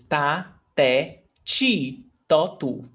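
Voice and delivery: ta, te, chi, to, tu with CHEE in third place; regional CH. Shown as a demonstration. Teacher lists her region's syllables, regional CH. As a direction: recite ta, te, chi, to, tu one by one. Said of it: "we say this".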